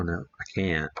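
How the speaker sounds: usual speaking voice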